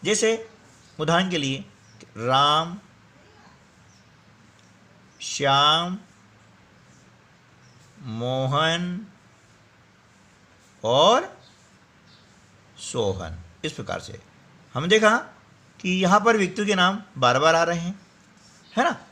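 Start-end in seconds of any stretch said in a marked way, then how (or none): 2.2-13.4 "राम", "श्याम", "मोहन", "और", "Sohan", long pauses between; pause at commas. Shown as a demonstration. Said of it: "थोड़ा सा रुकना होता है". ("राम, श्याम, मोहन और सोहन" हर शब्द पर रुककर)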